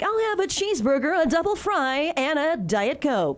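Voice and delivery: high newscaster voice ordering food; reporter voice ordering. Retold or imitated. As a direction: order fast food like an anchor. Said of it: "you don't talk like that".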